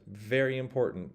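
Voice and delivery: ordinary delivery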